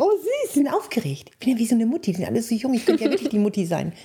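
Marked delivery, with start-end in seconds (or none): 0.0-1.3 high, fond little coo; fond aww. Retold or imitated. [name] thinks fondly of nervous couples, high coo. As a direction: coo it like a proud mom.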